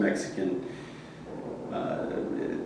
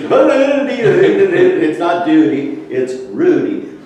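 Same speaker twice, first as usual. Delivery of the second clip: mock Norwegian singsong, drawn out high; imitating a Norwegian saying his name; Norwegian accent; 9 semitones higher, 8 dB louder, slower than usual. Norwegian accent while telling how Norwegians said his name. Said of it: "these different tones from the Norwegians".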